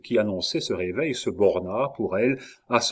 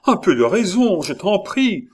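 high, raised begging voice; crying out in plea; 8 semitones higher, 5 dB louder than usual. A narrator's reading. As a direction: beg him urgently to calm down. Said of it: "cria Henri". (supplication criée, voix haute)

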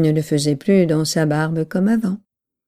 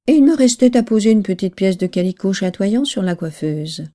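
ordinary delivery, nothing marked

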